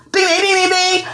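high, even dinging; high-pitched ring-side bell; mock fight bell. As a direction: imitate the bell opening a round. Imitates a battle round bell.

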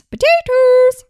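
very high, loud and drawn out; squeaky disguised voice. Retold or imitated. A presenter mimics a teacher's disguised quiz voice, very high and loud.

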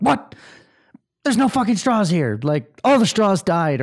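put-on sharp, high, irritated outburst at a four-year-old; mock snapping at a child. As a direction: bark it out, exasperated and impatient. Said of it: "like, I snapped".

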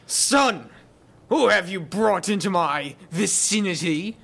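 lightly voiced king, little accent; a hint of the pompous king; King Richard voice, too light. GM voicing the king to the players, lightly. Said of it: "You weren't doing his accent enough".

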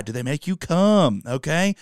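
ordinary delivery

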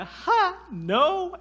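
high, loud mocking "Ha, no"; a flat refusal, scoffed up high; scoffing no. To Fox News, a high scoffing refusal. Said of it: "and I was like".

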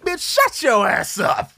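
slow, laughing through the insult; can't keep a straight face, drag it out. Retold or imitated. The boyfriend tells the therapist off, slow and laughing. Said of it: "I'm like".

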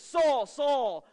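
loud urgent double call; God calling Saul. Retold or imitated. Preacher voicing God stopping Saul, loud urgent call. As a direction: call out to stop him on the road. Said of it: "He yells at Saul".

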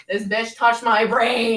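loud, slow, half-crying outburst; outraged cry. Real, not acted. Telling a friend about the nasal swab, loud pained outcry. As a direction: wail it out in disbelief.

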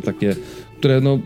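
usual speaking voice

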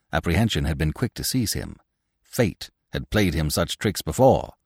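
ordinary delivery, nothing marked

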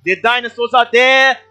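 loud, high play-by-play call; excited commentator calling the race; race announcer. Acted.

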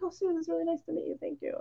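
lightly voiced polite star; polite Jeter. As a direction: play him gracious and brisk. Jeter politely greeting her.

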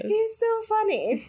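mock posh society voice, high and slow; rich socialite voice. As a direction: drawl it out like a rich lady. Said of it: "that's how you talk when you're rich".

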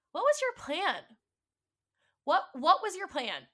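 loud, high furious questioning; shouting at the partner who lied; angry demand. Retold or imitated. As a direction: hurl the question at him. Confronting partner about secret child.

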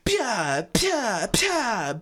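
high "pew" noise for a wand blast; imitated spell shots. Host mocking villains' spell fights, high imitated zaps. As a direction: fire off high mock zap noises.

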